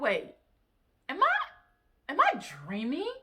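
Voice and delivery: high-pitched, incredulous questioning; high, halting double-take; retold disbelief. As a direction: act out baffled disbelief, pitch leaping up. Retold disbelief at her reply.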